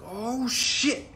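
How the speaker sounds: high, drawn-out "oh" of delight; excited recognition. Retold or imitated. Barista recognizing a local comedian, excitedly.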